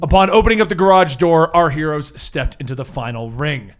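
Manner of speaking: dramatic recap narration; story narration. Acted. Game master opens the recap with storyteller narration. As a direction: open the tale like a storyteller.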